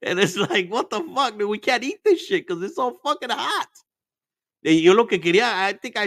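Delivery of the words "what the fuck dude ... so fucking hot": high-pitched quote. Retold or imitated. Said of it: "Frank beside me laughing his ass off".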